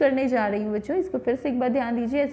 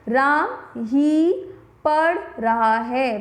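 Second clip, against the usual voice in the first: ही को ज़ोर देकर और धीरे बोलें; "राम ही" के बाद रुककर, ही पर बल; stress on ही; much slower than usual. Shown as a demonstration. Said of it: "ही वाला जो शब्द है इस पे थोड़ा सा जोर". (ही शब्द पर ज़ोर, धीमी गति)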